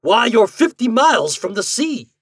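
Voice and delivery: incredulous countryman crying out; astonished cry. A narrator's reading. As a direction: exclaim it in sheer disbelief. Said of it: "cried landlord".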